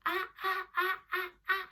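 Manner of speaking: high, loud repeated bursts; loud classroom chant; loudly. Shown as a demonstration. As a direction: belt each sound out high. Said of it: "Say it loudly".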